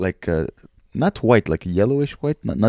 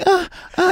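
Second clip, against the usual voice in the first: imitated moans. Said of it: "your hot mom going".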